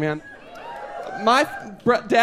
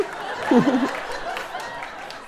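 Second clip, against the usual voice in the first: high squealing laugh; 4 dB quieter than usual. Real, not acted. Laugh after the panic quote.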